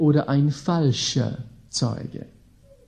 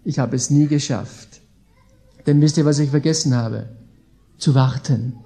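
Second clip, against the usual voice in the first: ordinary delivery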